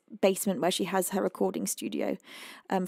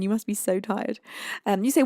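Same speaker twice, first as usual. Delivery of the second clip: usual speaking voice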